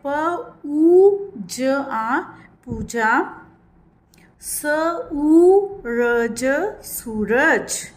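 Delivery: very slow letter-by-letter sounding; slow spelling out. Shown as a demonstration. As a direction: spell out for young learners. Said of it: "यानी कि long sound.".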